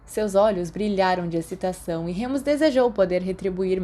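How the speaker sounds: plain reading voice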